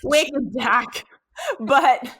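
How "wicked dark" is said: "Dak" for dark, Boston-style dropped R; mock Boston accent. Co-hosts joking about dark humor, mock Boston accent.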